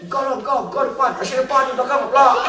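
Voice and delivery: squabbling participants. Acted. Participants argue over who introduces first, high and bickering. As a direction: shove the turn onto each other.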